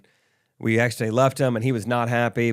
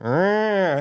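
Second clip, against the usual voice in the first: mock cow bellow; 7 semitones higher than usual. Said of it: "then the cow's like".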